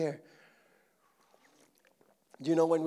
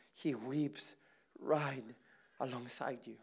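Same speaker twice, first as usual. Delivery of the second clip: soft, tender hush; 6 semitones lower, 12 dB quieter than usual. Acted. Pastor comforting the hurting, very softly. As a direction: lay it down gently beside them.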